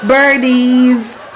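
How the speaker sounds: drawn-out coaxing call; playful slow bird call; calling birdies. Pretend. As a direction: coax the birds over, stretching the words. Pretends to call birds.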